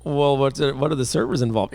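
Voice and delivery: normal talking voice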